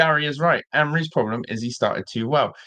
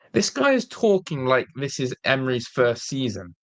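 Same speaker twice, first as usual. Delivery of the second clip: normal talking voice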